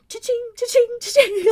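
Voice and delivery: high sing-song cha-ching; cash register. Retold or imitated. Actor's imagined thoughts, high sing-song cash register. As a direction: chant it like a till ringing. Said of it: "I know he's just thinking".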